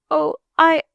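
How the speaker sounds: put-on high, halting, tearful child; little girl choking back sobs; holding back sobs. A narrator's reading. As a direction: stammer bravely through rising tears. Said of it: "bravely keeping back her sobs".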